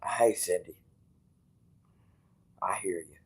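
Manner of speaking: whisper. Real, not acted. Tender whisper to wife.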